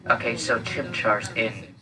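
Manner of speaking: normal talking voice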